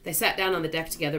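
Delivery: plain reading voice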